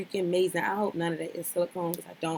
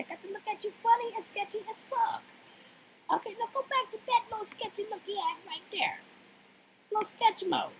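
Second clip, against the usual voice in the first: mocking sketchy; 13 semitones higher, 5 dB quieter than usual. Real, not acted. Mocking the man's sketchy look.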